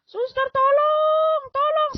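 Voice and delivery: slow, very high cry of "tolong"; high desperate yell. Retold or imitated. Patient calling the nurse at night, high desperate yell. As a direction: scream for the nurse with everything left. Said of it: "Saya teriak".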